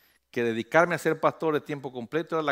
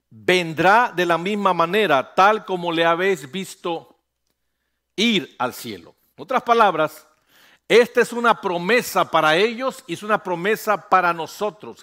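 loud, rising pulpit declaration; fervent preaching; 3 semitones higher, 7 dB louder than usual. Acted. Preacher proclaiming the second coming to the congregation, fervent and loud.

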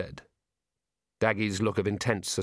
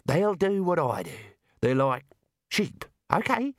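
talking ram. Acted. The ram talks to Rincewind.

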